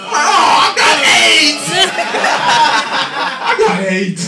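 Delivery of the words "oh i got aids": high, loud, slow panicked yell; panicked shout. Retold or imitated. Comic sneezed on by foaming man, panicked yell. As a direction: scream it in mock horror.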